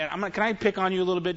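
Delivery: ordinary talk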